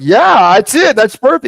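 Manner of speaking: loud, high-pitched delighted yes; excited agreement. Retold or imitated. Retelling how he agreed with his buddy, excited.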